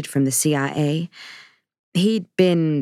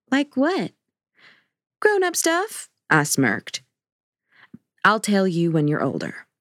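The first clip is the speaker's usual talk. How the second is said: higher, playful back-and-forth; bantering daughter and dad; 5 semitones higher than usual. Acted. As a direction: tease and recoil, lightly voiced.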